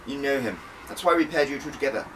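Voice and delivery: lightly voiced stern spymaster; brisk boss. Spy chief briefing her agent, brisk and commanding.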